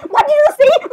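high, loud startled demand; shocked outburst. Acted. Princess cutting in on Elsa's remark, shocked. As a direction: burst in with a high, loud question.

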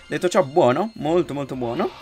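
loud, high satisfied praise; pleased. Real, not acted. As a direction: savour the good result. Pleased after winning the fight. (soddisfatto ed entusiasta)

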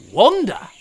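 high, loud, sudden realisation; light bulb moment; excited boy; eureka. A narrator's reading.

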